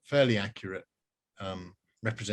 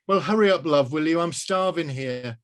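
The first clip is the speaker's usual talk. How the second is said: much higher, louder mum; 5 semitones higher, 5 dB louder than usual. Acted. Mum on the phone asking for fish and chips. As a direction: call like an impatient mum.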